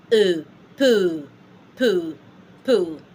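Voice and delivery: "Euh" then "poo" with the same rounded eu; slow repeats of "poo", spaced for learners to copy; each "poo" one clean falling syllable; French vowel drill. Shown as a demonstration. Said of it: "So you say".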